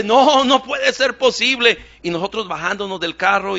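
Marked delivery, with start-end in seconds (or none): 0.0-1.8 desperate cry. Retold or imitated. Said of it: "y gritándole".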